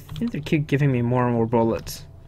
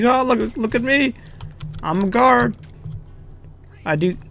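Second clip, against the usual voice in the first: high, smug, hammed-up guard; mocking guard voice; 9 semitones higher than usual. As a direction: parade it like a show-off guard strutting around. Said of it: "He was like".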